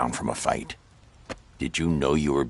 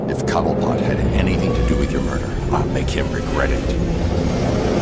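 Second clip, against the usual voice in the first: cold vengeful threat; 3 semitones higher, 6 dB louder than usual. Acted. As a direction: promise vengeance through your teeth. Vow of revenge.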